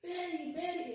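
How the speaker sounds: high, lightly voiced name call; high-pitched call, falling at the end; retold calling out. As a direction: imitate someone calling a name in a line. Retold stranger calling out her name.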